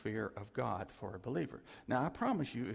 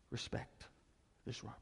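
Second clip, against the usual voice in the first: mock hushed reverence; 3 semitones lower, 10 dB quieter than usual. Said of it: "mock reverential respect to robber".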